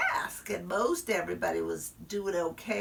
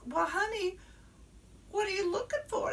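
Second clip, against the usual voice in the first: sweet teacher voice; 12 semitones higher, 3 dB quieter, faster than usual. Acted. Teacher voiced to lost child.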